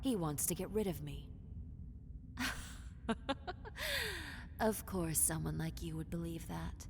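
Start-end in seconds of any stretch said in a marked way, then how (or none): 1.3-4.5 breathy pitched-up cackle; amused evil chuckle; high airy villain laugh. Acted.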